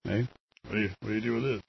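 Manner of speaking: normal talking voice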